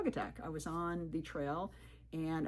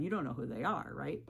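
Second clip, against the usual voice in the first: ordinary talk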